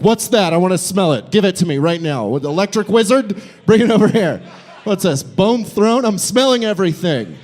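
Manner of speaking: hammed-up passionate dispensary mom; fired-up mom. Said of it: "fired up, passionate dispensary mom routine".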